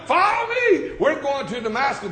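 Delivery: high shouted order to his men; rally the pack, Follow me; commanding shout. Acted. Preacher voicing Saul ordering his men to Damascus, shouted.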